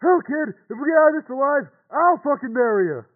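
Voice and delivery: loud, high holler back; rowdy high shout; shouted reply. Acted. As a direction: yell it back at full voice. Shouted joking answer over radio.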